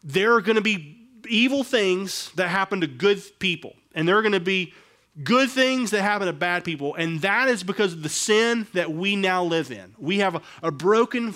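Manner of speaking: usual speaking voice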